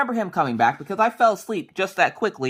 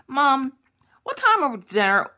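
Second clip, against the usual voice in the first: put-on high, drawn-out kid voice; pestering child; 9 semitones higher than usual. Daughter keeps coming down to ask mom, high pestering whine. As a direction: imitate a child calling for mom. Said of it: "she would come down, like, every ten minutes".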